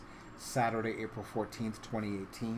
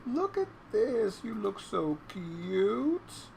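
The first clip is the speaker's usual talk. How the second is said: high, drawn-out cooing; cooing pet talk; 8 semitones higher, much slower than usual. Real, not acted. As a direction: coo slowly at an adorable pet.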